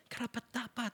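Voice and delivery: karapat dapat said softly, barely voiced; soft, weak praise. Shown as a demonstration. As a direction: mumble karapat dapat like a lullaby. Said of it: "Hindi ganun". (mahinang bigkas ng karapat dapat)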